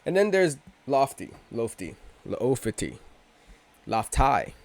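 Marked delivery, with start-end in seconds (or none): 1.5-3.0 Lofty then Loofty, oo drawn long; two ways to say it, slow. Shown as a demonstration. Offering both names to viewers, slow. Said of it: "however the hell you want to pronounce it". 3.9-4.5 Lofty with a long "tie" ending; Lofty, said as "Loftai". Shown as a demonstration. A third try at the name for viewers. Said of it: "however the hell you want to pronounce it".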